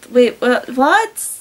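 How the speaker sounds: ordinary delivery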